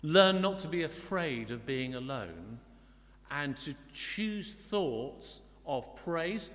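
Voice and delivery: ordinary delivery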